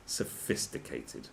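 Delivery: slow breathy scornful echo; mock whisper. Sneering at the blurb's word, slow mock whisper. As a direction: whisper the word with fake awe.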